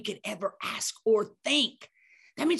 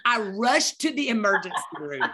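normal talking voice

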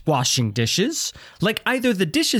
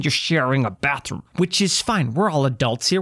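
ordinary delivery

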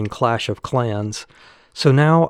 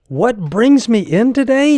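much higher and louder, repeating it in disbelief; incredulous echo; 11 semitones higher, 5 dB louder than usual. Retold or imitated. Patient echoing the young doctor's question, aghast. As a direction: throw the question back, aghast.

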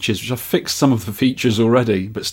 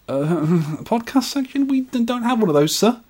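high, meek shop assistant; flustered sales assistant; 11 semitones higher than usual. Acted. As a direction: stammer an apology to a difficult customer. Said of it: "Him to sales assistant".

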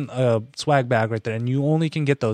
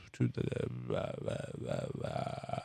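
slow motion; 16 dB quieter, much slower than usual. Pretend. Voice slowing and dragging down.

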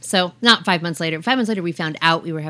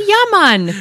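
put-on Jamaican accent; 12 semitones higher, 8 dB louder than usual. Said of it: "Jamaican accent".

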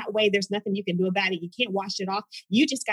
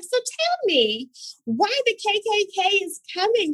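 high eager question; wide-eyed curiosity; naive curious self; 11 semitones higher than usual. Retold or imitated. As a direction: keep asking innocently.

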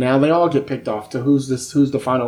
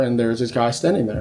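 usual speaking voice

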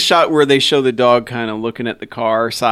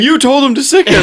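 blaming friend; 12 semitones higher, 5 dB louder than usual. Retold or imitated. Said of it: "he says".

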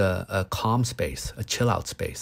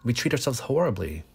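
ordinary delivery

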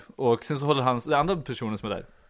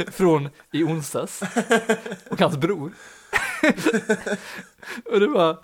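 words broken by laughter, slowed; laughing; 7 semitones higher, slower than usual. Real, not acted. Telling friend about the date's twist, laughing. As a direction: laugh through it.

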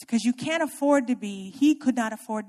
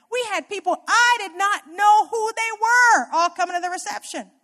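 excited disbelief; 8 semitones higher, 5 dB louder than usual. Real, not acted. Exclaims about strangers at reception.

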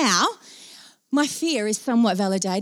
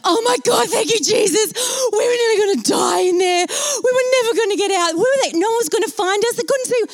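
high, loud, wailing outburst; hysterical relief; 11 semitones higher, 4 dB louder than usual. Retold or imitated. Rescued passenger to waiting colleagues, loud hysterical wailing. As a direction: wail it out in hammed-up hysteria.

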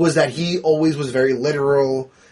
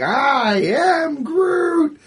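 high, long drawn-out mock yell; imitated Groot yell; 13 semitones higher, much slower than usual. Imitating Groot running away with the bomb, drawn-out yell. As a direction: stretch every word into a high cry. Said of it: "he starts yelling".

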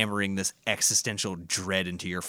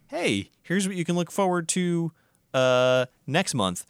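mock announcer; 9 semitones higher than usual.

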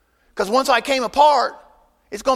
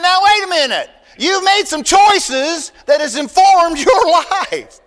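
mock scolding; 5 semitones higher, 6 dB louder, slower than usual. Scolding the cross-dresser at pharmacy.

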